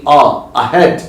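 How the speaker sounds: ahead with a short अ sound at the start, not ए; pronunciation demo. Shown as a demonstration. Teacher showing ahead begins with अ, not ए. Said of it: "इसको अ से बोलते हैं".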